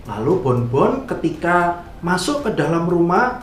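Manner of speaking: usual speaking voice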